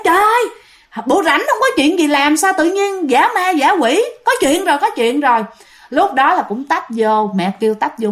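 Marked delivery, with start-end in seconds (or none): 0.1-5.4 higher, mock-scolding mother; retold scolding, pitch raised high. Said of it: "mẹ bạn la nói".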